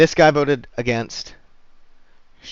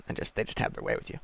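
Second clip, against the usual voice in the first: whispered; 9 dB quieter, faster than usual. Real, not acted. Grim joke about guards.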